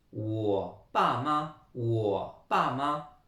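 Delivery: "wo pa ma" drawn out slowly, syllable by syllable; slow Chinese model. Shown as a demonstration. Teacher models the word for parents, slow and separated. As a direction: say "wo pa ma" very slowly for learners to copy. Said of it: "Ça voudra dire les parents aussi".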